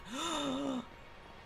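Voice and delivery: long, high gasp of amazement; drawn-out dramatic gasp; big gasp. Pretend. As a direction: suck in air in awe. Spots a Sky Trio.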